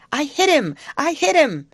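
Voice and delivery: high, loud excited yell; exultant high holler; triumphant shout. Acted. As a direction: shout your success across the water. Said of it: "Then he shouted".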